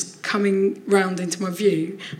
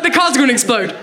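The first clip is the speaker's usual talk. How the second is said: panicked order; 4 semitones higher than usual. Retold or imitated. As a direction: bark it like the car might blow. Urging James to fetch keys.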